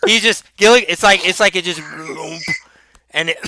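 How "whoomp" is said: soft gulping whoomp. Retold or imitated. Sound of toad swallowing Gilligan.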